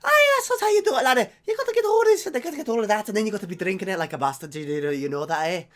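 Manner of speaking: hammed-up Irish-Scottish brogue, starting very high and loud; bad Irish accent. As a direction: open at a mock Irish shriek, then ease down into a fast ramble. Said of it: "not really good at the Irish accents".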